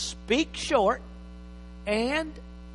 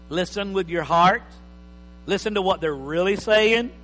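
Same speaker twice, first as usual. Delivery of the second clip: normal talking voice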